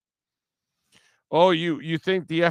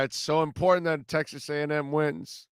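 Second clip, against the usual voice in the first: usual speaking voice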